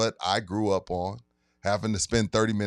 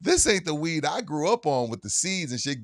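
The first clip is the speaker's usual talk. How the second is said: raised, outraged complaint; indignant; 6 semitones higher than usual. Retold or imitated. Complaining to the host about new weed, mock indignant. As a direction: protest like a fed-up old-timer. Quoting himself upset at weed.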